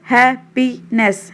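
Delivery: Happiness broken into three beats; split into syllables. Shown as a demonstration. Teacher showing three syllables, happiness split in three. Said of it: "तीन बार हुआ".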